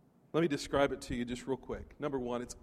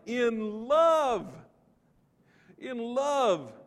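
raised proclaiming read, slowing on in love; scripture reading; 3 semitones higher, 4 dB louder than usual. Acted. Preacher reading Ephesians to the congregation, proclaiming. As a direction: lift the verses up to the room.

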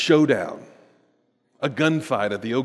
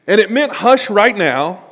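louder, higher insistence; raised voice, pressing the meaning; emphatic; 6 semitones higher, 7 dB louder than usual. Real, not acted. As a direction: drive home the point. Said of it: "explaining mother's hush".

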